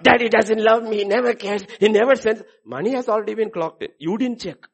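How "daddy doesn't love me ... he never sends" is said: louder, higher sulky grumble; child complaining about daddy, loud and whiny; loud whining complaint. Acted. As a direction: sulk loudly like a neglected child. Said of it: "I'm giving an example".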